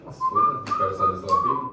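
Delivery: very high excited squeal. Real, not acted. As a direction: squeak it out in disbelief. Excited outburst about farting.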